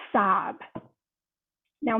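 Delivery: real tears welling as she tells it; tearful. Real, not acted. Tearful while recalling daughter's grief.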